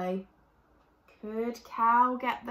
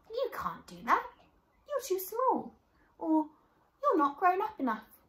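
mocking doubters; 8 semitones higher than usual. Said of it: "And they might say".

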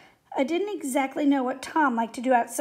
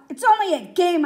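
louder, higher sudden protest; blurted out; 4 semitones higher, 7 dB louder than usual. A narrator's reading. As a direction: blurt it in defence of a friend. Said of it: "I blurted out".

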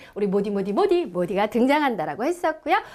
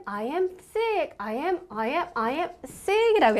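slow model sentence; much slower than usual. Shown as a demonstration.

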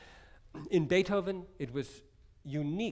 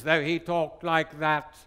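slow, louder, grand English lecture tone; English lecturer voice; 6 dB louder, slower than usual. Retold or imitated. Recalling composer Nicholas Moore's lecture voice, slow and grand. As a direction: lightly imitate a stately English composer. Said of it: "who talked like this in real life".

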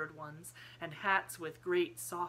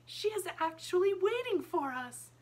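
put-on very high, loud child's voice; thrilled, high-pitched young girl; excited little girl; 13 semitones higher, 7 dB louder than usual. A narrator's reading. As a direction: marvel aloud with breathless-child excitement. Sarah thrilled at finding the doll.

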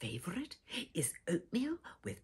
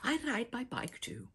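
ordinary delivery, nothing marked

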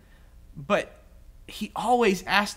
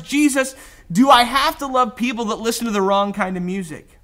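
louder, higher, pushy questioning; indignant self-justifying man; 4 semitones higher, 6 dB louder than usual. Retold or imitated. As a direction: hammer him with anxious loophole questions. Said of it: "In other words".

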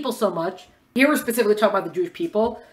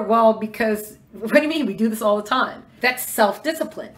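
normal talking voice

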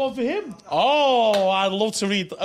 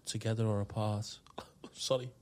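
whispering; 9 semitones lower, 13 dB quieter than usual. Acted. Poem line whispered, laughs.

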